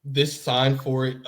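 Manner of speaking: ordinary talk, nothing marked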